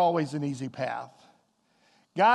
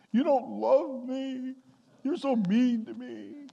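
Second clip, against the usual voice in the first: put-on higher, slow whining kid; whiny child; 5 semitones higher, slower than usual.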